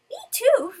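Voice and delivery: squealed high and loud, delighted; overjoyed high-pitched "me too"; giddy high squeal. Acted. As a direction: blurt it out like a lovestruck fan. Voicing character's happy reply.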